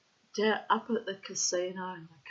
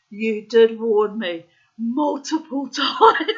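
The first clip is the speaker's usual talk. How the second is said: laughing; 10 dB louder, slower than usual. Real, not acted. Laughing while quoting.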